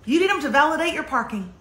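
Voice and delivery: normal talking voice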